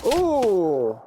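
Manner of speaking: normal talking voice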